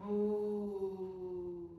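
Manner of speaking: a lowing cow sound, drawn out; mooing cow. Shown as a demonstration. Teacher mooing like a cow for the class. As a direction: moo like a cow for the kids. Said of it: "pretend to chew like a cow".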